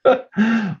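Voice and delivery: short, high-pitched laugh; amused laugh. Real, not acted. Guest reacting to host saying he's thirty-one and healthy, amused laugh. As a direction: burst out in a brief high laugh.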